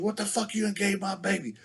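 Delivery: high, mock angry yell at Richard; auntie yelling. Auntie yelling at Richard over the kid, mock angry. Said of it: "she's yelling at Richard".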